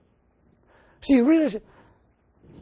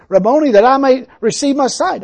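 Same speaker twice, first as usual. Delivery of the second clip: louder, higher plea; loud crying out; 6 semitones higher, 10 dB louder than usual. Retold or imitated. Preacher voices blind Bartimaeus begging Jesus, loud. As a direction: cry it out for sight.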